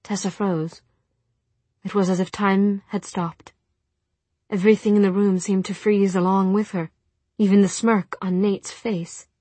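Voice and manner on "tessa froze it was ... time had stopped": usual speaking voice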